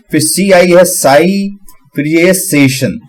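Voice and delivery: slow, split into syllables. Shown as a demonstration. Learner breaking a hard word into slow chunks. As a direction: break the word apart slowly. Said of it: "तो मैं क्या करूंगा कि".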